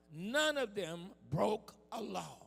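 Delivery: usual speaking voice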